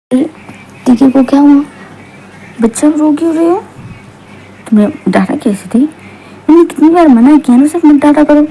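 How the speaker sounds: high-pitched, worked-up reproach; indignant scolding. Acted. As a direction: demand answers as an upset, protective father. Acted father scolds mother over crying child.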